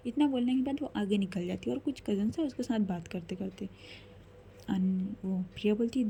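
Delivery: plain reading voice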